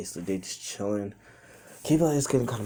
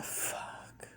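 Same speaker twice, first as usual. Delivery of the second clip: real whispered swear, under the breath; muttered curse; 14 dB quieter than usual. Real, not acted. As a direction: let it slip out as a hushed whisper. Stuck mid-story, swears under breath.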